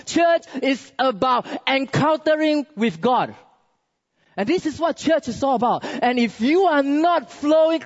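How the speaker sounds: insistent, high-energy sermon exhortation; forceful preaching. Real, not acted. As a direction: drive each point home to the congregation.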